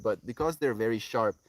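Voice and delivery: normal talking voice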